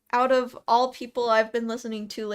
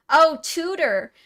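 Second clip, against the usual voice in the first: a bright, louder "oh" of getting it; sudden realization; 5 semitones higher, 5 dB louder, slower than usual. Real, not acted. Streamer realizing what chat meant by tutor, surprised. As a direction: catch on all at once and say it out. Suddenly grasps chat's word.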